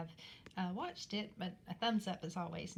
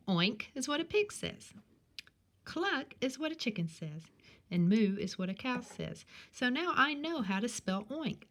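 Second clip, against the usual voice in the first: normal talking voice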